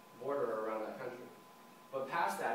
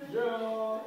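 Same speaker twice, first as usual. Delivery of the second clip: high, loud drawn-out yeah; hammed-up cheering; mock cheer; 7 semitones higher, 6 dB louder than usual. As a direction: whoop it like a celebration. Mock cheer at student's small praise.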